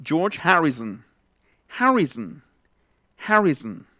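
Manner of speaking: '[name]' as HARRI-zn; schwa ending. Shown as a demonstration. Teacher giving a Beatle surname example, '[name]' ending in bare zn. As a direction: swallow the o at the end of '[name]'. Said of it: "No es son, es zn".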